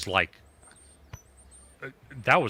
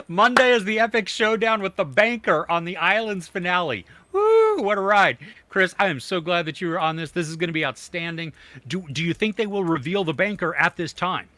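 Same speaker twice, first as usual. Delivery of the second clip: hyped sports announcer, higher and louder; commentator voice; 6 semitones higher, 4 dB louder than usual. Pretend. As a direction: call it like a big showdown announcer. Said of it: "You sounded like a commentator there".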